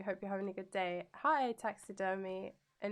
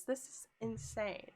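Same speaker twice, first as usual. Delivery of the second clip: ordinary talk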